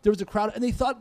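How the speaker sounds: ordinary talk, nothing marked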